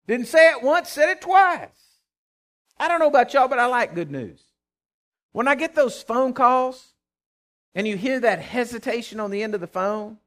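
high, loud preacher's shout; fiery preaching. Acted. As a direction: rouse the congregation. Preaching at full force.

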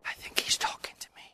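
breathy unvoiced whisper. Shown as a demonstration. Congregation whispering to a neighbour, unvoiced whisper. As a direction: lean over and whisper it. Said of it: "quietly whisper".

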